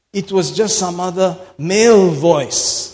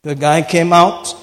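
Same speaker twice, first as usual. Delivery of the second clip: loud, rising sermon fervour; full-force preaching; 3 semitones higher, 5 dB louder than usual. Acted. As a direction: preach it hard to the crowd.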